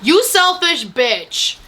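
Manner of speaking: high, slow, drawn-out insult; hammed-up outrage at a character; indignant scolding. Vlogger scolding the selfish man in the film, indignant.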